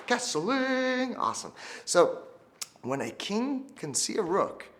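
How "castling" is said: prompt for class. Shown as a demonstration. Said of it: "Everyone say that".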